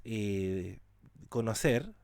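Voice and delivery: ordinary delivery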